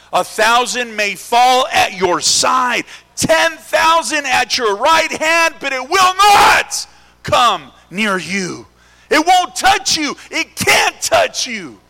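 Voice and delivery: slow, loud, high declaration; shouted slow preaching. Shown as a demonstration. Preacher proclaiming the psalm to the church at full shout. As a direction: hammer each phrase out slowly. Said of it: "Did you read that?".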